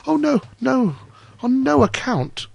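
high-pitched, anxious protest as little Hans; flustered, eager-to-please objection; alarmed cry. A narrator's reading. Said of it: "cried little Hans".

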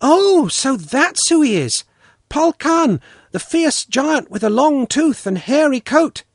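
put-on high, loud childish wonder; child's exclamation. A narrator's reading. Child finding out who Polkan is, exclaiming high and loud. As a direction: exclaim in a high little girl's voice.

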